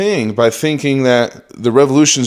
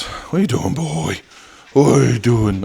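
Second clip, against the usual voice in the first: talking to the dog; 2 semitones higher than usual. Real, not acted. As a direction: coo at the pup who is upset. Talking to his dog Benji.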